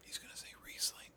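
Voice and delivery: hushed, knowing whisper; whispered aside. Real, not acted. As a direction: slip it in quietly under your breath. Aside predicting the co-host's answer.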